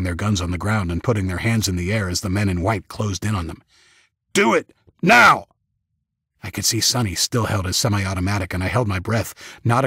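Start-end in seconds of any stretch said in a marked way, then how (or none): 4.3-5.5 loud, high, slow shouted command; hard military yell; yelled order. A narrator's reading. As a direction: shout it as a forceful demand. Same soldier repeats his yelled order.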